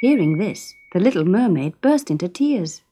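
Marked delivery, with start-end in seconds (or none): none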